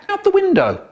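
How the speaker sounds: piping cartoon tone; squeaky high voice. High punchline.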